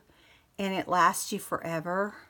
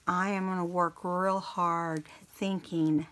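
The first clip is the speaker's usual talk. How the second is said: usual speaking voice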